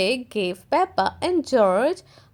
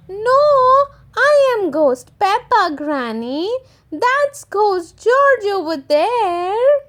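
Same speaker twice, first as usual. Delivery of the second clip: high, louder little piglet; Peppa correcting; 7 semitones higher, 4 dB louder than usual. Acted. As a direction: correct granny proudly.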